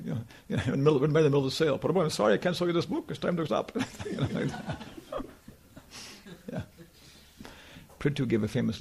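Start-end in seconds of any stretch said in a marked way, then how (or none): 1.8-3.7 a high, clipped German devotee calling time; mock the rigid German devotee, pitched up. Mocking the strict German devotee, a high imitating voice.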